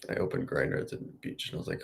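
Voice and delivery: usual speaking voice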